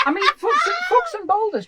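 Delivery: high, laughing disbelief; squeaky amused repeat of the slip. Real, not acted. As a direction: giggle through the blunder.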